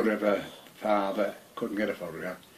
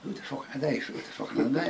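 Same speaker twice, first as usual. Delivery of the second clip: hushed question; 4 semitones higher, 10 dB quieter than usual. Retold or imitated. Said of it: "I said to them".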